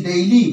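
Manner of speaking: ডেইলি with the first vowel gliding from e into i; correct pronunciation. Shown as a demonstration. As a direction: say ডেইলি as "dei-li", with an "ei" glide. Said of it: "এর সঠিক উচ্চারণ হবে".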